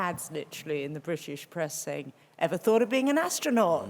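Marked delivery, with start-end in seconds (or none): 2.4-3.9 a hint of an American accent, raised and louder; slight American accent. Quoting an old British press ad, slight American accent. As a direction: read the ad with a light American accent. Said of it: "I did a slight American accent there".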